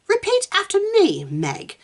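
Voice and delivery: high, schoolmistressy authority; calm authority. A narrator's reading. Susan teaching Meg proper Britishness, calm authority. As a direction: lift the voice, assured and instructive. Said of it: "with the calm authority".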